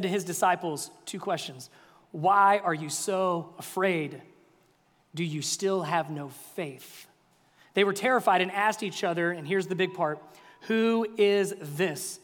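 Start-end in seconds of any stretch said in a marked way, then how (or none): 2.1-7.1 slow, weighty questions from Jesus; slow rebuke. Acted. Preacher voicing Jesus gently chiding his disciples, slow and weighty. 10.7-12.2 slow, drawn-out wonder; awed question. Acted. Disciples asking each other in awe, slow and drawn out.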